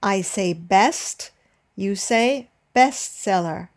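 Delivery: "best" then "bestseller" with the first syllable punched; teacher models cue and answer, stressing the first syllable; stress on first word. Shown as a demonstration. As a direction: example drill stressing "big" in "big bang". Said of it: "Listen to the examples".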